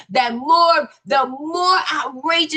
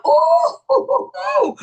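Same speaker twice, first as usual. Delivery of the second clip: high-pitched, drawn-out whoop; playful scandalized ooh. Acted. Host reacts to bold comment, high playful whoop. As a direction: let out a high teasing ooh.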